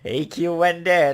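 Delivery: drawing it out, scandalized delight; teasing. Real, not acted. Player teasing about the innuendo.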